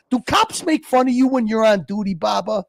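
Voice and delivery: ordinary talk, nothing marked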